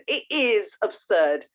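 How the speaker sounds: normal talking voice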